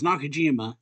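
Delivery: normal talking voice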